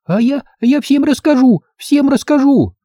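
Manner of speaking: put-on high, frantic girlish protest; squealing little girl. Girl threatens to tell everyone, high squealing child voice. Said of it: "Взахлёб заверещала девчонка".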